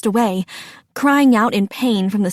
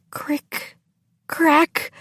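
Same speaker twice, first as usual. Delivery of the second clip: higher crick, then crack; cracking tree sounds; 4 semitones higher, 3 dB quieter than usual. A narrator's reading. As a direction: imitate wood starting to split. Narrator voices tree cracking.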